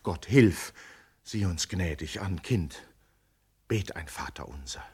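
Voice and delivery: fearful, softened prayer over the daughter; hushed anxious prayer. Acted. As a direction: plead quietly for mercy. Mother praying for her daughter.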